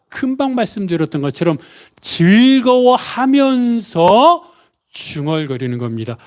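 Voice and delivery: normal talking voice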